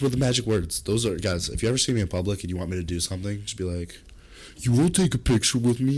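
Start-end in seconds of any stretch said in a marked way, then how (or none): 4.6-6.0 slightly higher, louder fan voice; lightly voiced fan asking a favor; eager fan. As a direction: pipe up like a fan. Acts out fan approaching him.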